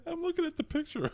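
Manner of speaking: high, soft laughing squeal; real laughing. Real, not acted. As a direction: squeak it out through helpless laughter. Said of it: "What are you laughing at now?".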